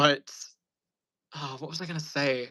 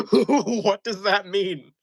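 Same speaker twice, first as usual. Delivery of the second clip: laughing; 7 semitones higher, 5 dB louder, much slower than usual. Real, not acted. Laughing at mom's nonsense.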